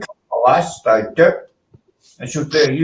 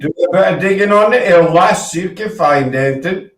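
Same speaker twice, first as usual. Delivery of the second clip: put-on broad Yorkshire accent, half-joking; Yorkshire accent calling someone lass. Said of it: "That's my accent".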